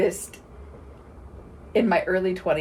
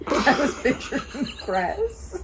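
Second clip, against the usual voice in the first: slow line broken by a real laugh; 6 semitones higher, slower than usual. Real, not acted. Host repeating her grass daydream while laughing. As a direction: laugh your way through the admission.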